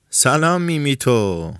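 loud, high, drawn-out hail; jovial shouted greeting. A narrator's reading. Café crowd hails the village fool, jovial shout. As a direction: call out merrily, stretching the words.

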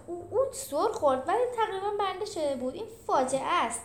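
high, agitated, mock-breathless voice; lightly acted breathless dismay. A narrator's reading. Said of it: "جولیوس که نفسش بند آمده بود گفت".

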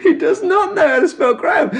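high, giggling disbelief; mocking a friend while cracking up; laughing. Real, not acted. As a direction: laugh it out, delighted.

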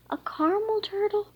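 high, loud, quick burst of delight; excited high-pitched exclamation; exclaimed. A narrator's reading. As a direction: gasp it out, thrilled at the idea of a turtle. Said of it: "Melanie exclaimed".

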